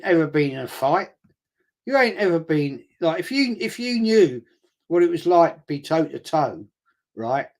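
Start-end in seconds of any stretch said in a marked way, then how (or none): none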